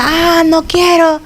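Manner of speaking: put-on high, slow childish whine; whiny child. Mother imitating her stubborn child, high whiny refusal. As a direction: mimic a sulky child refusing.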